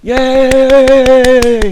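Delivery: very high, very loud cheer; imitated cheer. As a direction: cheer like a whole team celebrating. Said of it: "they went ... started clapping".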